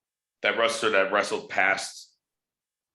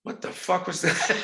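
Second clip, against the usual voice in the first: high, laughing what-the-hell; high-pitched incredulous laugh-line; laughing disbelief; 11 semitones higher than usual. Retold or imitated. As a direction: blurt it out half laughing, can't believe it. Stunned by a bad promo.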